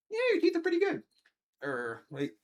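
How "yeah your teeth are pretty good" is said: squeaky high dog praise; sing-song high praise; high pet praise. Real, not acted. Praising the dog's teeth.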